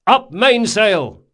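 slow, loud, high command; cried out. A narrator's reading. Captain to the crew, cried command. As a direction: send the order up the mast. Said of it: "cried Locke".

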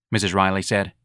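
ordinary delivery, nothing marked

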